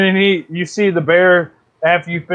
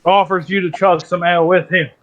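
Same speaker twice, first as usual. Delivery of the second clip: normal talking voice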